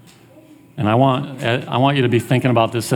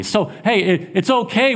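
ordinary talk, nothing marked

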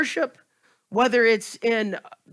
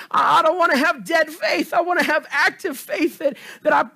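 preaching shout; 5 semitones higher, 6 dB louder than usual. Real, not acted. Preacher building to climax.

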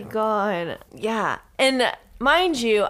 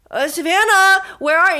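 louder, higher retold call; 7 semitones higher, 6 dB louder than usual. Retelling a phone call to find a friend, mock urgent call. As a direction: call out for a missing friend, half in jest.

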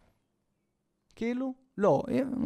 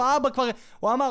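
ordinary delivery

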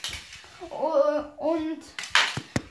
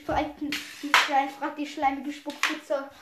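usual speaking voice

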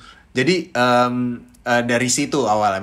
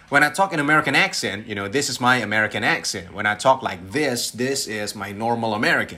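relaxed, slowing American English; American accent; 2 semitones lower than usual. Shown as a demonstration. Impressionist showing his normal American accent. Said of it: "pakai aksen American".

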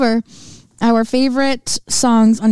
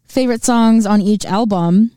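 ordinary delivery

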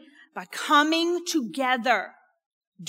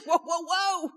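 high whoa; 5 semitones higher, 3 dB quieter than usual. Real, not acted. Reacting to the quarrel.

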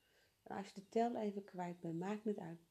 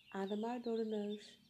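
ordinary delivery

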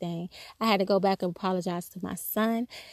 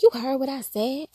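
rude clerk retort; 5 semitones higher, 4 dB louder than usual. Retold or imitated. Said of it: "Just like that".

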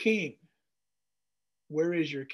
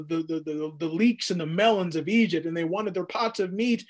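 ordinary talk

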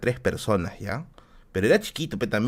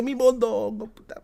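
lamento exagerado; 11 semitones higher than usual. Retold or imitated. Lamento al vomitar.

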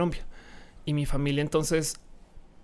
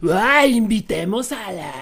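mock comedians; 5 semitones higher, 9 dB louder, slower than usual. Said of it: "ahí ves a todos los comediantes".

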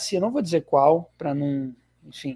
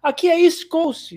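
high, slow, yelled gang boast; robber shouting; 9 semitones higher, 4 dB louder, slower than usual. Retold or imitated. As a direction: bellow it like a street robber. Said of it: "o ladrão gritou assim".

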